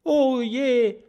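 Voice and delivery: hoch aufseufzendes Oh je; verzweifelter Seufzer. Acted. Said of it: "mit einem verzweifelten Seufzer". (Stacheldrache fürchtet, selbst Vampir zu werden, seufzend)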